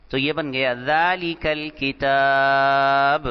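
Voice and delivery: ذالک الکتاب run together, hamza dropped, kaaf linked to laam; joined reading demo. Shown as a demonstration. Teacher showing how the hamza drops when words join. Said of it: "ذالکہ کو الکتاب کے ساتھ ملایا".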